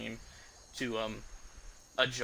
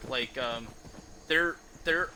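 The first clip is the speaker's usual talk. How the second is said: ordinary delivery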